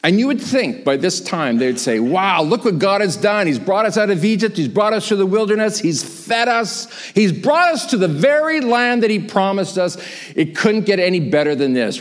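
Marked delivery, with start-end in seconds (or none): 2.0-12.0 high-pitched, excited list of blessings; amazed gratitude. Acted. Israelites at the promised land, acted elated awe. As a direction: keep the wonder up through each exclamation. Imagined Israelites praising what God did.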